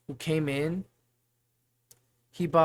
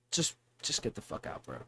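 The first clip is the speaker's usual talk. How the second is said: whispered retort; 5 semitones lower, 9 dB quieter than usual. Retold or imitated. Muttered retort to the customer.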